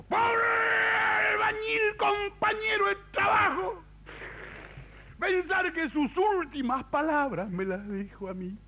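put-on very high, slow drunken wail; insistent drunk mourner. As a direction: wail it out in mock grief, stretching words. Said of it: "esos borrachos que insisten". (borracho que insiste, lamento agudo y arrastrado)

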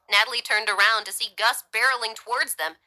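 ordinary delivery

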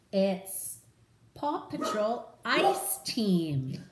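slow, drawn-out title reading; title announcement. Acted. Reading the book title to kids, drawn-out.

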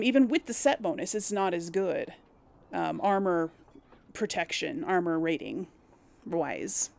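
ordinary talk

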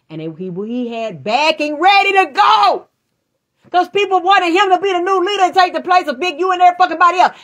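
high, loud, full-throated yelling; raging at the killers, voice near breaking from shouting; furious shouting rant. Real, not acted. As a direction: scream it out in real outrage. Ranting furiously about the killing.

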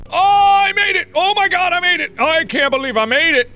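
high excited relief cry; relieved laughter. Real, not acted. Cleared hard level.

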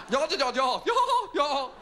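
very high, loud nonsense babble; squealing mock yah-yah phone talk; mimicked English woman. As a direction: parody English chatter as a French listener hears it. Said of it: "this English woman saying".

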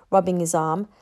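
plain reading voice

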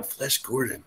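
very soft, hushed aside; mock-furtive, barely voiced note to self; secretive mutter. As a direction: say it under your breath, as if secretly jotting it down. Mimes someone secretly writing it down.

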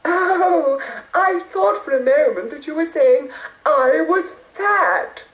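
high hurried fairy; a sweet high fairy in a rush. A narrator's reading. Fairy relieved.

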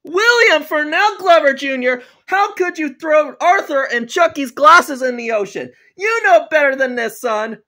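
loud, high angry-dad telling-off; screaming scolding. Acted. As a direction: yell like a father laying down the law. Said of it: "You don't need to scream at me".

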